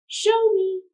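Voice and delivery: put-on light, high, coaxing girl voice; Vashti encouraging. A narrator's reading. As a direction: urge him on kindly. Vashti challenges the boy.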